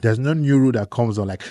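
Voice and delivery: usual speaking voice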